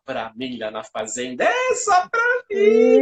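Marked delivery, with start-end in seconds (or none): 1.4-3.0 high, slow proud squeal; gleeful boast. Retold or imitated. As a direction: gloat like a delighted kid. Kid boasting delight.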